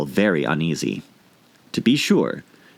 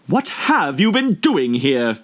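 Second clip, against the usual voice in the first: demanding queen; 7 semitones higher, slower than usual. A narrator's reading. Queen interrogates gardeners.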